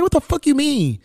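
high-pitched, baffled comeback; incredulous retort. Retold or imitated. Replying to cousin's kingpin text, incredulous high-pitched retort.

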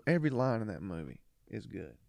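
ordinary talk

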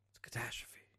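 whispered in mock dread; hushed fake terror, no voice at all. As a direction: breathe it like a spooky secret. Mocking doom whisper.

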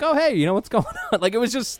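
breezy casual. Retold or imitated.

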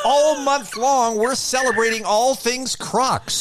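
slow, bright promo voice; ad read. Acted. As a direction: read it like a radio spot. Reading a Crocs ad.

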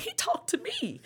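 "talked" hoog en nadrukkelijk uitgerekt; stress on talked. Retold or imitated.

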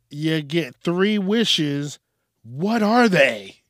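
loud, slow, drawn-out question; big announcer read. Acted. Reading the last question to the audience, big announcer delivery. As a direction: boom it out slowly like a host.

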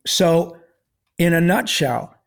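usual speaking voice

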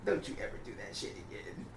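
pulling his friend aside, quiet stern warning; low private warning. Retold or imitated. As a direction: pull him close and warn him quietly. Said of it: "I had to pull him to the side".

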